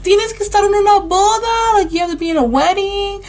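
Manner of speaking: high, loud scolding mother voice; angry mom. Retold or imitated. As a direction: shriek at your kid for chopping her hair. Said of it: "she's like".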